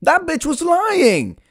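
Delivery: high-pitched, drawn-out outrage; hammed-up betrayed cry; indignant realization. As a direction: wail the accusation, stretching it out. Realizes she was lied to.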